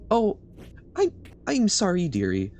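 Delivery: put-on high, fluttery old granny voice; sweet old lady voice. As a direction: dither like a kindly grandma. Stalling a scam caller.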